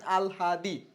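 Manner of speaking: "আলহাদি" in separated syllables, al-haa-dee; pronunciation demo. Shown as a demonstration. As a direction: show learners how "আলহাদি" sounds, stretching haa. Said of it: "এভাবে উচ্চারণ করবেন". ("আলহাদি" ভেঙে আল-হা-দি উচ্চারণ)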